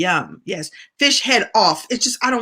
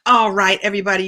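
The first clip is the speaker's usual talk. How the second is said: usual speaking voice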